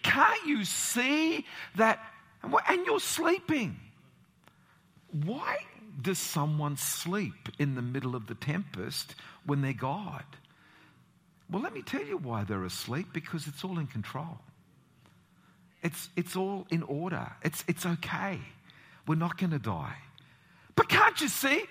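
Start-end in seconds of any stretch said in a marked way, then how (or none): 0.0-3.8 high, loud, slow desperate cry; frantic disciples. Retold or imitated. Disciples crying out to sleeping Jesus in the storm, high and loud. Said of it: "they're saying". 20.7-21.6 high, loud incredulous question; Jesus answering. Retold or imitated. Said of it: "Jesus answers the disciples".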